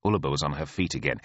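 ordinary delivery, nothing marked

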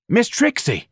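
high, sharp exclamation of reproach. A narrator's reading. Office manager to his elderly employee in a nightgown, sharp. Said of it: "a very sharp tone".

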